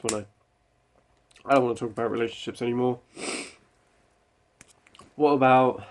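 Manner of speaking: ordinary delivery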